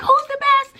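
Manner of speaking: put-on high, loud rallying cry to the kids; cheerleading teacher firing up her students; hyped teacher call. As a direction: pump the class up with a high shout. Teacher hyping up her class.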